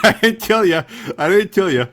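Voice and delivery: loud, high, amused protest; laughing denial. Real, not acted. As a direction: laugh while denying the blame.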